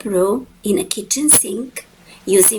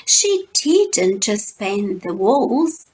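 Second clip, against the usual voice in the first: ordinary delivery, nothing marked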